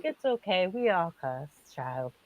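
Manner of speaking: soft, low priestly reassurance; forgiving priest. Retold or imitated. Priest forgiving a woman who swore, soft and low. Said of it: "And he's like".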